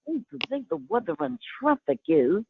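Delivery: on air reading traffic as Junice; Mae West impression. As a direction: purr it like Junice, Mae West's sister. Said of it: "impression of," but I call it Junice, Mae West's long-lost sister".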